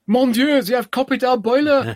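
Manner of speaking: put-on high, outraged French accent; indignant Frenchman, French accent; french chef. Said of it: "he says".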